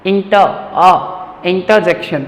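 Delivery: "inta... aa... inta-jekshan" for इंटरजेक्शन; correct pronunciation. Shown as a demonstration. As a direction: isolate the "aa" replacing R in इंटर. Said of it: "मैं आर sound नहीं कर रहा हूँ". (इंटर में र की जगह आ)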